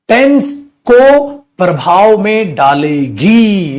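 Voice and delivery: slow dictation. Shown as a demonstration. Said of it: "चलो copy करते रहना बेटा साथ साथ".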